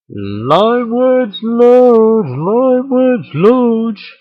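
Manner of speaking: big booming slow announcer intro; drawn-out announcer call, high and loud. Shown as a demonstration. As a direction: stretch the name out like a game show host. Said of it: "Something like that".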